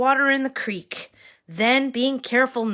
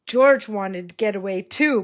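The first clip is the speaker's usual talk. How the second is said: ordinary delivery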